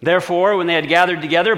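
ordinary delivery

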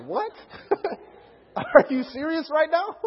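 high, laughing disbelief; incredulous siblings. Retold or imitated. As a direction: pitch it up in amused, mock outrage.

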